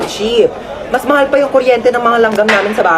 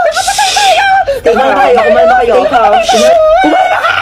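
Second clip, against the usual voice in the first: shrieking in high panic, much higher and louder; panicked shriek; 4 dB louder than usual. Acted. Panicking driver shrieks after hitting a pedestrian, high screaming. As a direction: scream that you killed someone, laughing in terror.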